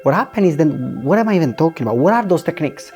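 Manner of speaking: normal talking voice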